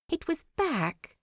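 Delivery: ordinary delivery, nothing marked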